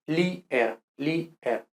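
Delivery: "lier" split as li-er; two syllables. Shown as a demonstration. Teacher drills the ending, li-er in two syllables. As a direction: say "lier, lier" with i and e in separate beats. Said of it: "en dos sílabas".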